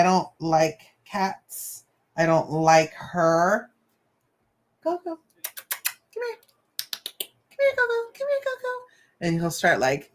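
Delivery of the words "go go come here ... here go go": much higher sing-song calling of "Go, go"; kid calling the cat. Retold or imitated. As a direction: call the cat like a little boy would.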